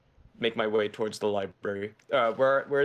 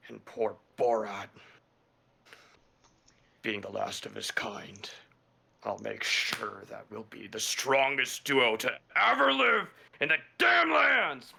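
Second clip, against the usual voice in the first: muttering to angry vow. Acted. Vowing to himself over the boulder, muttering that builds into anger. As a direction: begin under your breath, erupt on the final words. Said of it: "Just pure anger. Just muttering to myself".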